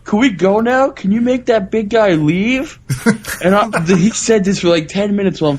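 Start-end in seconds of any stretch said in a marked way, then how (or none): 0.0-2.8 higher, drawn-out child's plea, mimicked; crying little kid. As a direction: imitate a whiny kid pleading to leave. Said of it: "he starts crying. He's like".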